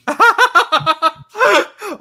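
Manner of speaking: loud, high peals of laughter; high-pitched cackling laugh; real burst of laughter. Real, not acted. Reacting to frozen video.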